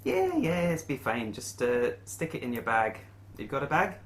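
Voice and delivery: lightly voiced quest-giver Willie; lightly put-on voice, lifted at phrase starts; innkeeper Willie. Innkeeper offering adventurers payment options, lightly voiced character.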